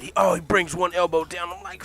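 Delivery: ordinary talk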